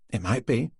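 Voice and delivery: "It might be" lifted at the end like a question; questioning inflection. A narrator's reading. Woman answers about a pay rise, "It might be" with questioning rise. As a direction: turn "It might be" into a tentative question, rising on "be". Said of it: "the inflection in her voice turning it into a question".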